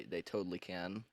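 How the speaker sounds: ordinary delivery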